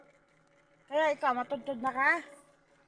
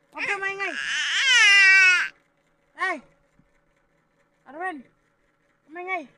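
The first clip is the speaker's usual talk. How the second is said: higher, louder playful scold; hammed-up stern hush; mock scolding; 5 semitones higher, 15 dB louder than usual. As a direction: pretend to hush the baby loudly.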